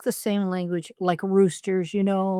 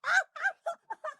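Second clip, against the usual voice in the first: squeaky high giggle; 5 dB quieter than usual. Real, not acted. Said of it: "That sounds like monkey".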